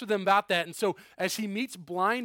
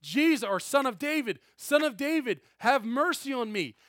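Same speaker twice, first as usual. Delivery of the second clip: higher and louder desperate plea; crying out; 6 semitones higher, 4 dB louder than usual. Retold or imitated. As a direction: call out to Jesus over the crowd. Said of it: "blind Bartimaeus starts crying out".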